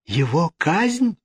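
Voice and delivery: high, drawn-out question; shocked cry. A narrator's reading. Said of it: "вскричала итальянка".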